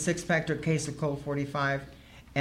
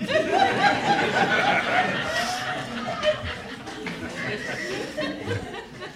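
long, high-pitched laugh; big high laugh; 3 dB quieter than usual. Real, not acted. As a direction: burst out laughing at yourself. Laughs at her own story.

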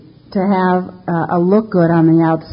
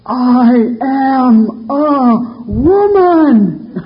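higher, louder, very slow declaration; frightened protest; 6 semitones higher, 5 dB louder, much slower than usual. Retold or imitated. Terrified driver telling the cop at gunpoint, loud and slow. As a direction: spell it out so he finally gets it. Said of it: "And I said".

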